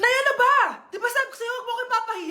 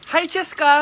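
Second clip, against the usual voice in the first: normal talking voice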